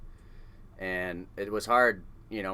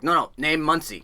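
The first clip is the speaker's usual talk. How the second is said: loud, higher broken-English correction; insistent foreigner; 8 semitones higher, 9 dB louder than usual. Retold or imitated. Foreign crewmate insisting on the nickname, loud and blunt. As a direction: overrule him loudly and firmly. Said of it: "Czechoslovakian that barely spoke any English".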